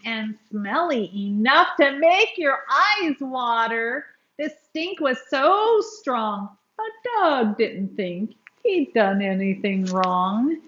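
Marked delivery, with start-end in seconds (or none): none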